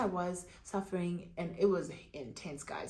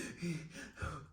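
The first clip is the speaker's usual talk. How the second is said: unvoiced gasp; 3 semitones lower, 12 dB quieter than usual. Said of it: "literally going".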